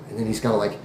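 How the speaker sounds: ordinary delivery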